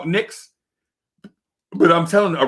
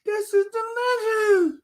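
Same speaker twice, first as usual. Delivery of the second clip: much higher, squealing with amazement; high mock-ecstatic gushing; amazed delight; 10 semitones higher than usual. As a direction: rave about the taste like a revelation. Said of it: "I'm like".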